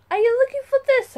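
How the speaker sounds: high, loud and fast gotcha; spring the trap on him; triumphant taunt. Acted. Daughter catching her father out, triumphant.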